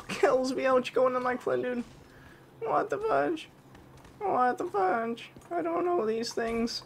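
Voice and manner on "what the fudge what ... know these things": slow, very high squeaky voice; silly high voice. Pretend. Gamer confused by the game, slow high squeak. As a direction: stretch it out in a goofy squeak. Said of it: "I gotta stop doing that voice".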